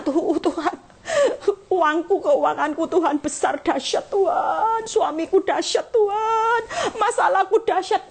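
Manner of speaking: high, wailing complaint with laughs and a fake sob; mock whiny prayer. Preacher shows the wrong prayer, piling problems on God, high and wailing. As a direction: overdo the self-pity, teasing. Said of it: "jangan katakan sama Allah".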